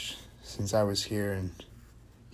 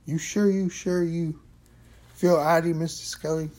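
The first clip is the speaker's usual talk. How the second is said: put-on stern old custodian; 8 semitones higher, 7 dB louder than usual. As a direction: press him like a worried old man. Custodian gets stern, worried.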